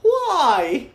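high, loud whale voice. Acted. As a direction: ask it like a big whale. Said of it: "do the whale voice".

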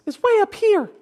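high put-on squeaky voice; mock natural voice, pitched high; way up here. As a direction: jump up into a fake high voice. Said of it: "but I talk down here".

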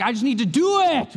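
high, loud mock pep talk; mocking self-talk. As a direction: ape a desperate man psyching himself up.

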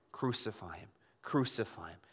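very low, soft crowd chant; understated retold outcry. Crowd insisting to Pilate after his protest, low and quiet. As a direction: repeat it darkly under the voice. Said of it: "they cried out".

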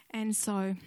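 usual speaking voice

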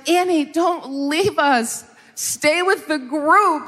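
high, slow, drawn-out scolding plea; acted parental plea. Parent scooping up the found child, acted slow high plea. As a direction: stretch each word in a high imploring voice.

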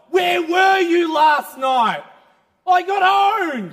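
high, loud, slow shout; loud indignant demand. Acted. Man yelling at Jesus after another bad night, slow and loud. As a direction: drag out each word in outrage.

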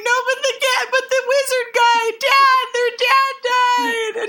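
put-on kid explaining desperately. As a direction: plead like a flustered kid correcting herself. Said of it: "I'm like".

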